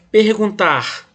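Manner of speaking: teacher's demo of "Perguntar", leaning on "tar"; stress on last syllable. Shown as a demonstration. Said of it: "a sílaba forte foi no, na última".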